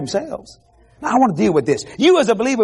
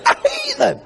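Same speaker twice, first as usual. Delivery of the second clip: mock squeal; slower than usual. Preacher repeats mocking exclamation.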